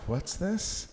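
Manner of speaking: high curious question. Retold or imitated. Reader finding a rabbit hole, high and curious. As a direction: pop up with sudden curiosity. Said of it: "And then it's just like".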